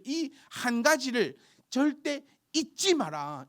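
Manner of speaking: high, loud and slow, every word weighted; emphatic scripture quote. Acted. Preacher reading Peter's command aloud, high and slow. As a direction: proclaim it as a command from the text. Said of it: "마지막 말씀 보십시오".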